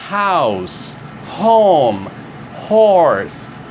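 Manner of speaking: aspirated H on Horse, Home, House; English pronunciation. Shown as a demonstration. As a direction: breathe out each H clearly. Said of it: "Esse é o som do H". (H aspirado em Horse, Home, House)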